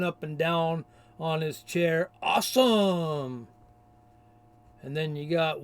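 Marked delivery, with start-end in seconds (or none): 2.2-3.5 a drawn-out, hammed-up gushing "Awesome!"; mocking fan gush. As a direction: mimic a silly fan, slowly. Mocks a commenter's gushing reply.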